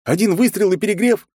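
high, loud outraged question; indignant disbelief. A narrator's reading. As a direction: sputter in disbelief. Hero outraged at overheat.

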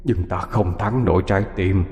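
soft, eerie ghost calling her; a spirit luring her to follow; beckoning ghost. A narrator's reading. (giọng hồn ma thì thầm dụ dỗ)

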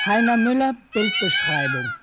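loud title call. Acted.

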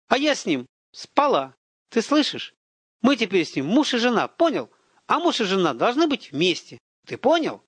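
high taunting that builds into a shout in his face; spiteful taunt building to a yell; mocking, rising to a yell. A narrator's reading. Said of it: "она уже кричит мне в лицо".

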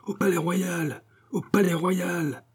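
high, slow crowd chant; high chanted rallying cry; crowd's cry. A narrator's reading. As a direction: call it out like a mob. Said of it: "cria la foule".

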